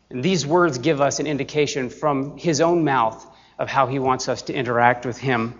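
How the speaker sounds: ordinary talk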